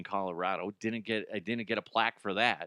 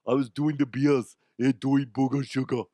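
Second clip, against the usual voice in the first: goofy German-accented action-star impression; Arnold; 3 dB louder than usual. Confessing he lost the trophy, action-star impression. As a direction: play it as a mock Austrian strongman confessing. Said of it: "that's, that's Arnold".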